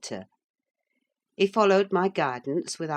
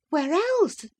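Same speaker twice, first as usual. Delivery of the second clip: high, quick retort; wife teasing her husband; Catherine, teasing; 13 semitones higher, 3 dB louder than usual. Acted. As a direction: toss it back at him lightly.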